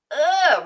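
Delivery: loud, high groan of disgust; a germaphobe recoiling, loud and high; disgusted ugh. Retold or imitated. Imitating the woman's disgusted ugh. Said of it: "really disgusted".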